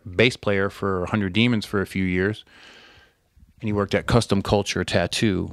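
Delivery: ordinary delivery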